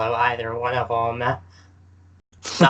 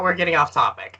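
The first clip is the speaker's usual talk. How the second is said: ordinary delivery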